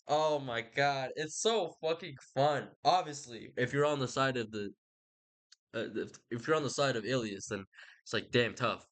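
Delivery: normal talking voice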